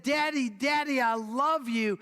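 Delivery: put-on excited child. Little girl greets daddy.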